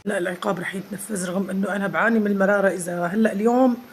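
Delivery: loud, heated complaint; loud protest. Real, not acted. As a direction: shout the protest over them.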